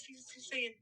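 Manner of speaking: usual speaking voice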